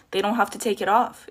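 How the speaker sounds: normal talking voice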